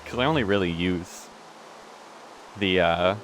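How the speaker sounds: talking through hiccups; hiccuping. Real, not acted. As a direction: speak between hiccups. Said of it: "Oh my god, I'm hiccuping so much".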